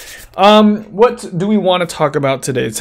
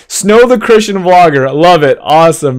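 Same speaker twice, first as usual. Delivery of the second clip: excited; 2 semitones higher, 6 dB louder than usual. Real, not acted. Greeting a viewer in chat.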